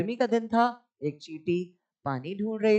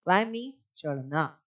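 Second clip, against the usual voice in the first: ordinary talk, nothing marked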